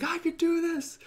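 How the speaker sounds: high, strained "I could do this!" pep talk; psyching himself up, way up high; self-pep shout; determined cry. Retold or imitated. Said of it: "I'm like".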